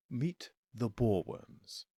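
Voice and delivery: slow hushed whisper. A narrator's reading. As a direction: breathe it out slowly and softly. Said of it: "he whispered".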